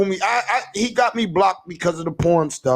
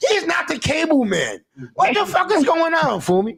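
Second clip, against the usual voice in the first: exasperated rant; 6 semitones higher than usual. Real, not acted. Ranting at Fumi about Chop.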